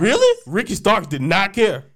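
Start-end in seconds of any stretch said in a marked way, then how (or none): 0.0-0.4 high, loud astonished Really?; shocked squeal of Really; surprised. Real, not acted. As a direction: jump up in disbelief. Astonished reaction.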